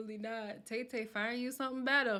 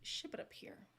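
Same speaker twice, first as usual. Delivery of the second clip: softer whispered repeat; 12 dB quieter than usual. Real, not acted. Begging viewers for Southern men, a soft whisper. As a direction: whisper it again as a plea.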